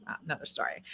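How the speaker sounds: a throwaway aside, barely voiced; whispered aside. Real, not acted. Joking aside to viewers about a next book, whispered. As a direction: whisper it under your breath. Muttered aside after a joke.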